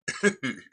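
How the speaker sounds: short, high giggle; brief laugh after the retelling; light, quick chuckle; amused chuckle. Real, not acted. Amused by the retold scene.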